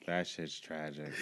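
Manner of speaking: half-laughing aside; quiet laughing repeat. Real, not acted. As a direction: mutter it again as the laugh dies. Repeats it under his breath.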